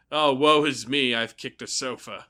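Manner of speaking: mock-tragic wounded soldier; hammed-up lament. Adult with a stubbed toe, mock-tragic woe. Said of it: "some sort of war veteran going".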